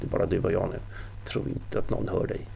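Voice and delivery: soft, menacing half-whisper; breath-heavy low threat; hissing threat. A narrator's reading. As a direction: keep it hushed and threatening. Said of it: "väste Ingvald".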